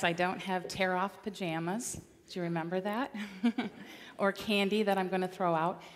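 ordinary talk

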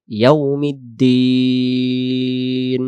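"ইয়াউমিদ্দিন" chanted slowly with a very long final ee; drawn-out DIN. Shown as a demonstration. Teacher modelling the word "ইয়াউমিদ্দিন", long drawn-out দিন. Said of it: "দিন টান দিয়ে পড়বো".

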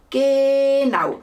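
loud, high, drawn-out agreement; emphatic confirmation. A narrator's reading. Ink monster confirming the boy's guess, emphatic. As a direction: stretch it out in delighted agreement.

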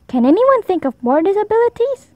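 high, encouraging teacher question. A narrator's reading. Teacher inviting the class, bright and encouraging.

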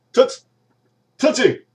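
high, loud yell across the office; calling out. Acted. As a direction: holler for the secretary to come in. Calling secretary again.

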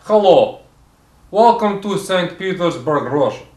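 Russian TV chef with slow, deliberate delivery; comic Russian accent, drawn-out name; put-on Russian accent. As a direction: introduce a cooking show in a thick Russian accent.

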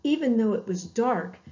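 ordinary delivery

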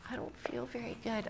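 small, whispered complaint; hushed, voiceless whisper; whispered child confiding. Retold or imitated. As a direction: whisper it to mom like a queasy kid. Child confiding in mother, retold.

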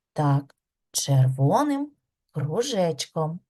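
ordinary delivery

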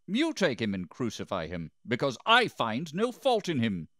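rising into a high, loud protest; insistent refusal. A narrator's reading. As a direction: push the decision back at them, building. Pilate pushes back at accusers.

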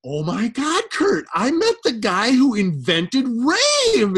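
high, loud, slow breathless mom impression; mom's gushing voice. Son imitates his mom gushing about meeting [name]. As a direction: gush like a starstruck mother. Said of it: "she was just like".

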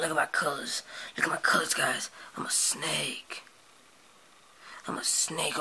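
hushed snake showing off; whispery snake. Acted. Snake puppet showing off his colors to viewers, whispered.

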